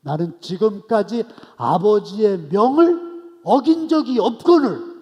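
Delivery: loud, slow, indignant protest; talking back angrily. Acted. The elder son talking back to his father, loud and indignant. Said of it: "아버지한테 막 대드는 거야".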